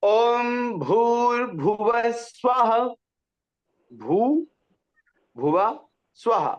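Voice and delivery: slow demonstration with full breathy bh in भूर्भुवः; clear articulation. Shown as a demonstration. Teacher models clear pronunciation for two students, exact भूर्भुवः. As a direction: separate भूर्भुवः into drawn-out, distinct syllables with aspirated bh. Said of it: "स्पष्ट उच्चारण में कैसे होना चाहिए".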